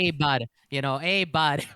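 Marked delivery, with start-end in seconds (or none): 0.0-0.5 mock Midwestern greeting; hammed-up Midwest accent. Shown as a demonstration. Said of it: "I hate that Midwest accent". 1.0-1.7 louder mock Midwestern greeting; parodied Midwesterner; Midwest accent. Shown as a demonstration. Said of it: "I hate that Midwest accent".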